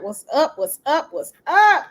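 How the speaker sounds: high, loud, eager hello; bright shouted hey; excited greeting. Real, not acted. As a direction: call out a big welcome to new viewers. Greeting viewers joining the live.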